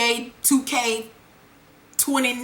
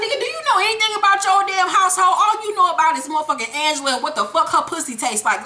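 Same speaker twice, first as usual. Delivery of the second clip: fast, pitched-up rant at a clueless dad; high, hurried mock outrage; exasperated scolding; 5 semitones higher, faster than usual. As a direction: tell him off in rapid disbelief. Speaker scolds the character in outrage.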